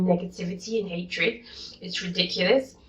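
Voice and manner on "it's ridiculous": ordinary talk, nothing marked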